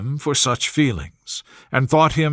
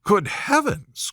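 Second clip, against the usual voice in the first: put-on startled, slow, raised young lady's cry; Elizabeth crying out; 5 semitones higher, slower than usual. A narrator's reading. Elizabeth hearing of Darcy's betrayal, shocked cry. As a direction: gasp it out in shock. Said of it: "cried Elizabeth".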